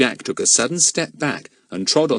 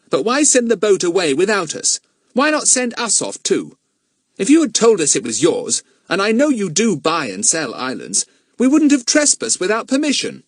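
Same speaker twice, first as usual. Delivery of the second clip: put-on much higher, louder boy's voice; boy Jack; 12 semitones higher, 5 dB louder than usual. Jack explaining himself to Mister Eppie, boyish protest. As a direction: answer as a high-voiced, defensive boy. Young Jack's character voice.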